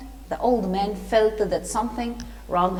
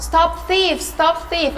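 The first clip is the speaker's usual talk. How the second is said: high, raised cry of alarm; shouted alarm; 6 semitones higher, 4 dB louder, slower than usual. A narrator's reading. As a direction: call out to stop a fleeing thief. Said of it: "they started to shout".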